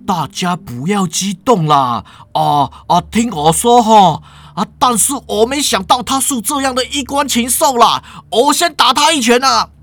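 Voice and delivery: hasty professor. Acted. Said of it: "梁教授连忙就说啦".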